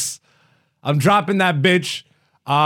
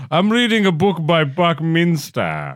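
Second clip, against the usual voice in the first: slow, put-on British delivery; mock British accent; 2 semitones higher than usual. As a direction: savor the name in hammed-up British. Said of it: "do a British accent".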